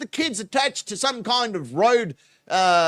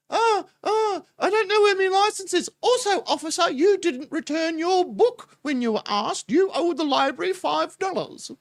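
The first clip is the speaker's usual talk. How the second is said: high, put-on British lady voice; fussy librarian falsetto with a fake British accent; mock British librarian; 10 semitones higher than usual. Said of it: "why she's British, but she is".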